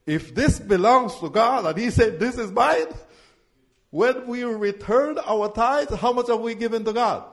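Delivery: loud, driving call-and-response preaching; full-force preaching. Acted. Pastor calling the tithe verses to his congregation, loud preaching.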